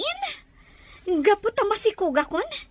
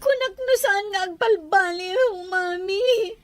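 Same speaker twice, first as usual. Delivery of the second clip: acted crying. Daughter pleading with her mother, crying. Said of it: "Awan tayo taas ang sangitam".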